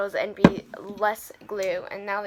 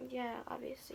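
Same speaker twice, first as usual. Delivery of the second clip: whispering; 16 dB quieter than usual. Real, not acted. Whispered aside.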